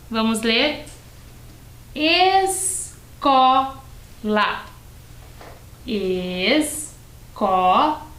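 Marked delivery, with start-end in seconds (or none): 1.9-3.8 "Escola" as ES, CO, LA, high and very slow; syllable by syllable. Shown as a demonstration. Teacher reads what is written, syllable by syllable. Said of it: "Uma palavrinha com três sílabas". 5.8-8.1 "Escola" as ES, CO, LA, very slowly; syllable by syllable. Shown as a demonstration. Teacher repeats a three-syllable word for pupils, syllable by syllable. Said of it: "Uma palavrinha com três sílabas".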